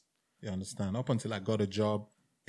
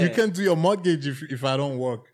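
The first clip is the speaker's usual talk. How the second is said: exasperated reply; 7 semitones higher, 7 dB louder than usual. Retold or imitated. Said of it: "I said".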